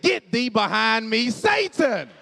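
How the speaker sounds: loud shouted rebuke, rising at Satan; mock rebuke. Husband rebukes wife's doubt, preacher shout. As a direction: cast her out like a preacher.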